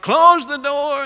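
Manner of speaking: high, loud, slow shout; yelled. Retold or imitated. Stranger yelling at whoever left the door open, loud shout. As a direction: bark it across the room against the cold. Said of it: "Somebody yelled".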